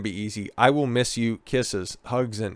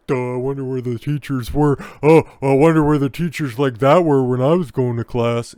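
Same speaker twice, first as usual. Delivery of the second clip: dopey mock voice of a conservative commenter, louder on the second line; mocking trad con; 2 semitones higher, 6 dB louder than usual. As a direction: sneer it like a smug know-it-all. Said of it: "those trad cons that are out there".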